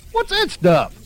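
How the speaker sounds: put-on a rough kid demanding; blunt kid. A narrator's reading. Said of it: "Leroy wanted to know".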